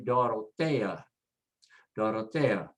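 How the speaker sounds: Dorotea as doh-roh-TEH-ah, weight on TEH; next-to-last stress. Shown as a demonstration. Teacher shows stress goes next to last, penultimate stress. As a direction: stress the E in Dorotea, A kept separate. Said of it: "stress goes next to the last".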